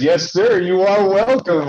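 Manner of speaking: smug wife. Retold or imitated. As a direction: lay the smugness on thick. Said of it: "And she goes".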